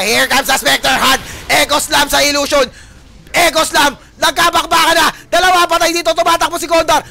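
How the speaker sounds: high, very loud shoutcast at full force; excited play-by-play. Real, not acted. Caster calling a team fight to viewers, excited shouting.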